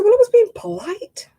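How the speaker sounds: high, innocent excuse; mock-innocent defensive reply. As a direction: protest your innocence lightly. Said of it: "I said".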